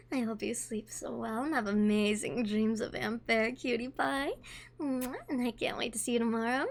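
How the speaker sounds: soft, low, tender bedtime mommy; very quiet, soothing put-on mother voice. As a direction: tuck them in with a hushed goodnight. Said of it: "Okay, I'll give you the mommy voice".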